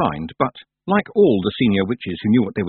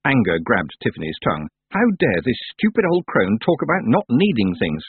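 ordinary talk, nothing marked

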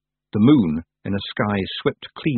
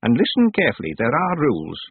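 lightly voiced stern old witch; 6 semitones higher than usual. A narrator's reading. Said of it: "said the old witch".

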